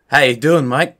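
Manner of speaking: hammed-up South African greeting; South African accent. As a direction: attempt a South African accent, faltering at the end. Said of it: "channel my District nine".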